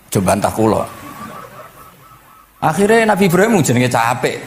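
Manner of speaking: usual speaking voice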